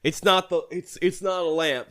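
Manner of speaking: ordinary talk, nothing marked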